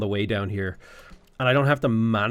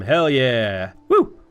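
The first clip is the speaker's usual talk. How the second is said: excited cheer; 6 dB louder, much slower than usual. Real, not acted. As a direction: cheer it out loud. Cheering choosing fascism in game.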